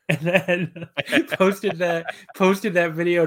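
laughing. Real, not acted. Laughing while retelling.

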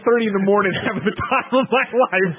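words breaking into a laugh; high, giddy, laugh through the line. Real, not acted. Host to co-hosts at one thirty in the morning, laughing. Cracking up over late recording.

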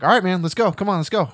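insistent mock rallying; urging a friend. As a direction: hurry your buddy along. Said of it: "And your guy's like".

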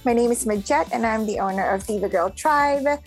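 ordinary talk

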